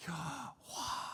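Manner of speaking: very soft breathed Yahweh. Shown as a demonstration. Preacher illustrating that God just is, name breathed softly. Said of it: "pronounced like a breath".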